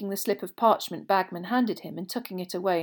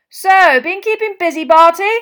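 high, loud, hearty question; breezy; 11 semitones higher, 16 dB louder than usual. A narrator's reading. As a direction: boom a carefree hello, rising at the end. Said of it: "said Bagman breezily".

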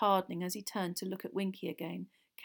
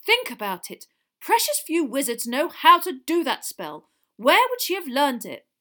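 loud, raised-pitch remonstration; loud reasoning; 7 semitones higher, 12 dB louder than usual. A narrator's reading. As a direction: urge a colleague loudly to think. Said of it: "said Mister Weasley loudly".

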